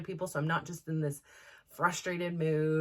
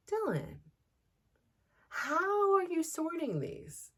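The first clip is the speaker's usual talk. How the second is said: very high, slow, gentle question; sweet teacher voice; 13 semitones higher, slower than usual. Retold or imitated. Teacher asking Dylan how he sorted, high slow gentle voice.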